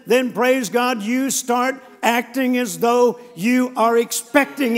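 driving, insistent sermon delivery; preaching at full force. Real, not acted. Preacher urging the congregation to rejoice once they pray, emphatic preaching. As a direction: press each phrase home like a fired-up preacher.

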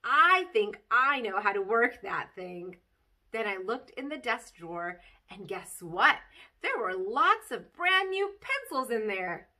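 put-on lively read-aloud of a kid's chapter book; bouncy child-narrator storytelling; story reading. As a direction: tell it like a bedtime story to children. Reads Junie B. story aloud.

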